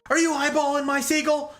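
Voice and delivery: high, strained drill instructor yell; mock screaming. Instructor catching recruit watching seagull, mock scream. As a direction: imitate an outraged instructor screaming. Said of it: "And he's screaming at him".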